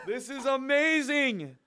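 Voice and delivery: high, slow, drawn-out delight with a laugh; laughing, thrilled high exclamation; overjoyed. Real, not acted. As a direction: savour it in high amazement. Reacting to surprise record gift.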